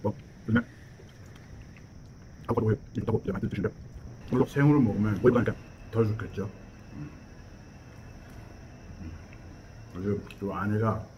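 talking while chewing; eating avocado between words; mouth full, chewing. Real, not acted. Seen 4: Chewing throughout; spoonful of avocado taken into mouth at 1.5 s.

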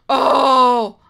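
sharp gasp then a loud oh; loud startled gasp. Real, not acted. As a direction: gasp in fright. Gasps at attack.

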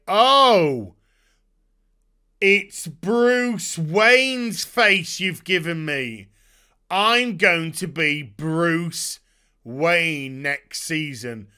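slow mock wonder, dragged out; slow, mocking villain realization; drawn-out mock dismay. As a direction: stretch each word like a disbelieving villain. Hush's drawn-out reaction quote.

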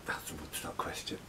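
a breathy whisper under the breath; whispered aside. Real, not acted. Muttered aside by the host.

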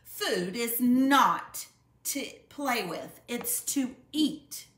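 slow, loud scolding shout; mother scolding her cubs at the table, slow loud shout. A narrator's reading. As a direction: lay down the law, word by word. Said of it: "shouted Mama".

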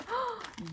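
sharp high intake of breath; delighted gasp. Real, not acted. Vlogger unpacking fan mail, a delighted gasp. Spots something in the mailer.

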